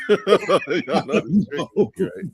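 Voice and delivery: real laugh breaking through the words. Real, not acted. Host admits to co-hosts being triggered, laughing. Cracking up at what triggers them.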